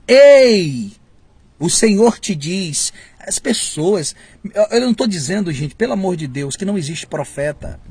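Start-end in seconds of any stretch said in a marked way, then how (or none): 0.1-2.9 loud high "Ei!" then slow "O Senhor te diz"; mock showy prophet. Preacher parodying a prophet's staged announcement. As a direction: ham up the theatrical prophet. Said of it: "Já observou isso?".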